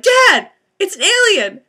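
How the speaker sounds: high, loud, slow insistence; urgent alien claim. Retold or imitated. Daughter insisting to dad it's an alien, high and urgent. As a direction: plead that it's real. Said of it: "And I was like".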